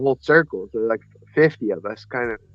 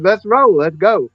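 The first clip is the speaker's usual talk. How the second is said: higher and louder, pushing to move now; fired-up call to get going; eager urging; 8 semitones higher, 7 dB louder than usual. Retold or imitated. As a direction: hurry your buddy out the door. Urging his friend to hurry.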